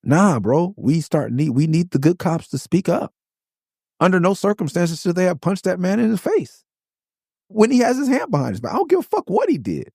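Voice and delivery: heated, high-pitched indignation; outraged rant. Real, not acted. Challenging anyone who defends the police punch, outraged and high-pitched.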